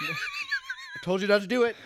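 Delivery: high-pitched laughing scold. Real, not acted. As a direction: say it while cracking up. Laughing scolding after joke.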